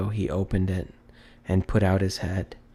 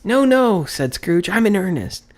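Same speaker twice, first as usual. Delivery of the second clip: earnest insistence; 10 semitones higher, 6 dB louder than usual. A narrator's reading. Scrooge insisting to boy.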